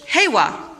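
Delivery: heiwa slow, even syllables hei-wa; Japanese heiwa. Shown as a demonstration. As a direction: model heiwa for the class. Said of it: "Say heiwa".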